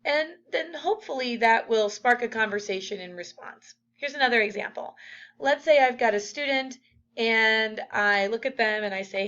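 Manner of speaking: ordinary talk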